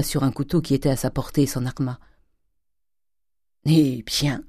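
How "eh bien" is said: cool, unimpressed challenge; coldly. A narrator's reading. Father facing his armed daughter, coldly. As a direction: answer with cold restraint. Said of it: "lui dit froidement Grandet".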